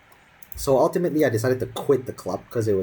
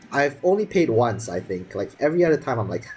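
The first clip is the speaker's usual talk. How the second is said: ordinary delivery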